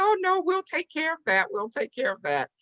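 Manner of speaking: high imitated voice waving it away; generous couple. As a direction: mimic kindly insistence, starting way up high. Couple generously offers to pay.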